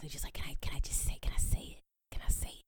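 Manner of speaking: mock pleading in a whisper; whispered begging. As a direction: whisper it like a furtive, needy request. Acting people begging for permission.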